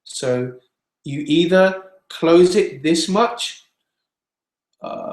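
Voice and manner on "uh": low creaky vocal-fry "uh"; vocal crackle. Shown as a demonstration. Singing teacher showing students loose fold closure, crackly creak. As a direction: let the vocal folds crackle on a held uh. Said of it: "like a little crackle on the voice".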